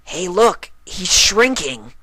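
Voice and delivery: very high, loud and slow call; high loud astonished cry; excited shout. A narrator's reading. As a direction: point and shout in amazement. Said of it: "Rainbow Dash said".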